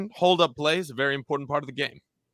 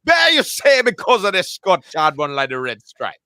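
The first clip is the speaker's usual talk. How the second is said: higher, louder put-on Jamaican accent; 7 semitones higher, 7 dB louder than usual. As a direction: slip into a hammy Jamaican accent. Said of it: "in the Jamaican accent".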